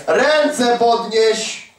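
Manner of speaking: preacher slowly drawing out an order to raise hands; slow command. Retold or imitated. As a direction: spell it out very slowly to the congregation. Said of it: "Mówię, mówię".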